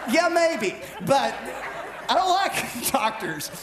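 high, slow, laughing through the words; chuckling it out at his doctor wife. Retold or imitated. Answering his doctor wife, laughing.

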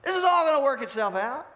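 high, cocky boasting voice; a smug king gloating at the prophet. Acted. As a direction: gloat like a king who thinks he has won. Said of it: "he looks at Jeremiah and says".

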